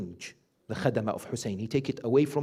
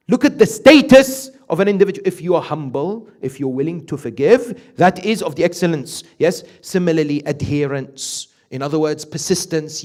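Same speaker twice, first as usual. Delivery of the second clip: loud, higher preaching voice, driving each point home; fervent preaching; 5 semitones higher, 11 dB louder than usual. Acted. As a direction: preach it out to the congregation. Preaching at full force.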